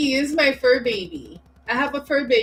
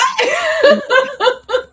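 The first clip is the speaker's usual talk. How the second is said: a high, loud laugh bursting out; high burst of laughter; 9 dB louder than usual. Real, not acted. Guest hearing about a sleep podcast bursts into high laughter. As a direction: crack up in disbelief.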